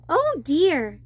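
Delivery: usual speaking voice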